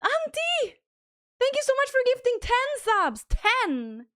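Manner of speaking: overjoyed thanks for ten gifted subs, high and loud; thrilled shout of thanks. Real, not acted. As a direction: squeal the name, then pour out thanks.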